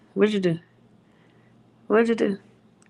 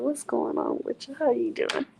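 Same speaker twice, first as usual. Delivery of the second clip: whisper; 5 semitones higher, 7 dB quieter than usual. Acted. Whispered greeting to viewer.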